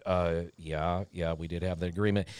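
retelling Carl caught out by the boss, meek quick mumble; shrinking meek mumble. Retold or imitated. Program director cornered in the boardroom, sheepish low mumble. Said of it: "Carl's starting to shrink".

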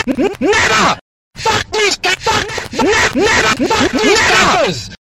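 very high, loud shrieking chant; hammed-up screaming rant. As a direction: scream it like a raging gamer. Ranting chant against campers.

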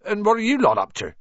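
quick, gruff giant's voice; suspicious Hagrid. A narrator's reading. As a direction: probe them, suspicious and fast.

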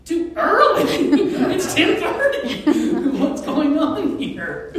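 high, loud incredulous laughter; a preacher mocking a complaint with big laughs; laughing disbelief. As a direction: laugh it off as absurd. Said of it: "I'm like".

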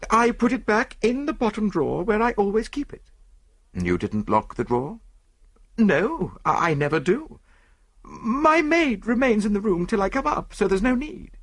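high-pitched rich woman answering the police; lady of the house. Acted. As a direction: voice the wealthy lady, high and precise.

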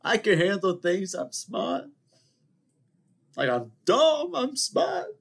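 very high, aggrieved movie outburst; Godfather Two impression. Older brother insists he is smart, high-pitched impression. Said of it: "I love that scene".

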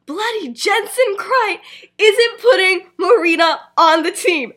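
high, loud, slow proclamation; loud announcing. Acted. Marlene telling the whole common room, loud outrage. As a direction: blurt it to the room, word by word.

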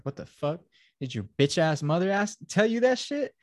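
high-pitched, rising mock outrage; imitating an affronted dad, pitch shooting up; mock incredulous father. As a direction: retell it as an indignant, climbing question. Retold angry father, incredulous.

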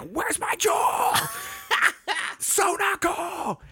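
high screeching scream, pitched far up; imitating the singer's full screamo scream; screamo shriek. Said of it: "full screamo".

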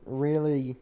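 ordinary delivery

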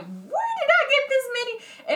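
high-pitched mock disbelief; exaggerated high, puzzled surprise; retold astonishment. Retelling surprise at story views.

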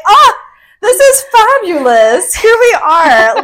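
high, loud, slow pampered goddess voice; lounging goddess. Retold or imitated. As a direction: savour it like a queen being pampered. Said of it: "laying there like a goddess".

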